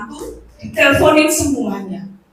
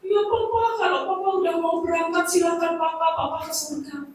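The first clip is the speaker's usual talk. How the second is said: put-on higher, soft, tearful old wife; crying grandmother; 7 semitones higher, 5 dB quieter than usual. Grandma tearfully releasing her dying husband, higher and soft. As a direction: softly let him go, voice lifted and teary. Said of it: "Omanya nangis".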